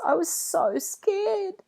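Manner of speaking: high, slow tearful voice; bursting into tears. A narrator's reading. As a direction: sob the words out as the relief breaks. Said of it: "He burst into tears".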